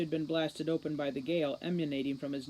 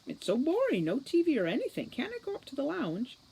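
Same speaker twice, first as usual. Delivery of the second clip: high, whining complaint; whiny; 9 semitones higher than usual. Acted. Juliet whining to Holly about the boring room. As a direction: whine like a bored kid.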